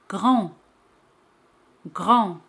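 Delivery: grand ending on a nasal vowel, no D; grand in French, D unsounded; silent final D. Shown as a demonstration. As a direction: say grand with the last d left mute. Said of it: "D al final no se pronuncia".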